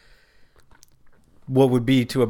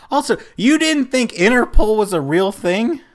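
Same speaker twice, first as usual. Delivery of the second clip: incredulous; 10 semitones higher, 6 dB louder than usual. Real, not acted. Mocking friend's ignorance.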